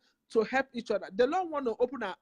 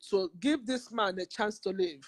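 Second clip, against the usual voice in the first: usual speaking voice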